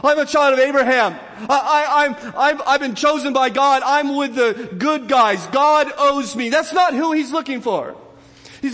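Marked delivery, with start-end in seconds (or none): 0.1-6.5 high, loud, smug self-righteous boasting; hammed-up holier-than-thou brag; mocking the proud. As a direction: lampoon a puffed-up believer bragging, with a laugh. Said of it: "people who go around saying".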